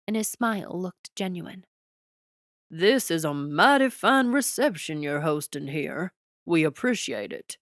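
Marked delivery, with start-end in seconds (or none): 2.7-7.6 hearty Texas president, a touch louder; folksy Texas warmth, lightly voiced; Texas twang. A narrator's reading. The Texas president compliments the prince's reception, hearty twang. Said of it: "booming voice held a distinct Texas twang".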